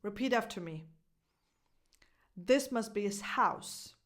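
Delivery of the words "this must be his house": "his" unstressed as "iz", full H on "house"; neutral, H-less his before a clear "house"; dropped H in HIS. Shown as a demonstration. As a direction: link "be-iz", then a strong H in house. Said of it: "pronounce it with a neutral inflection".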